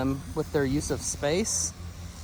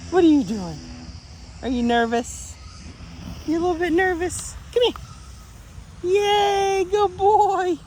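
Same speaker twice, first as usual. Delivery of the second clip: much higher, gentle coaxing building to a loud cheer; high soothing dog talk; 3 dB louder, slower than usual. Real, not acted. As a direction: coax the nervous pup then cheer him. Soothing then cheering nervous dog.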